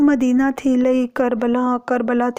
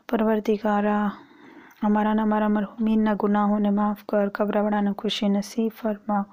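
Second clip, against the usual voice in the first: closing prayer; 2 semitones lower, 3 dB quieter, faster than usual. Acted. Closing supplication.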